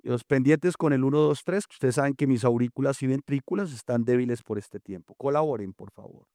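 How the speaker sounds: ordinary talk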